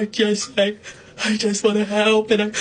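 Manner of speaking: half-hearted fake sobbing; mock sobbing. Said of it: "she was sobbing".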